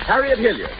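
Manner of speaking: ordinary delivery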